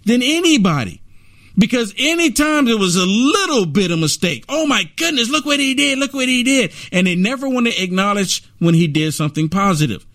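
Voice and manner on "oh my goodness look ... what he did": mock outrage. Mocks the left's outrage.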